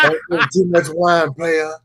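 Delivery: hammed-up repeat of a funny line; mock quote of the clip. Quoting clip, laughing.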